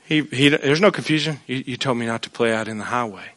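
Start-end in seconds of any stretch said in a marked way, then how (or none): 1.5-3.2 put-on small, much softer child's answer; meek child reply. Scolded son answering his parent, very soft and meek. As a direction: mumble it like a scolded kid.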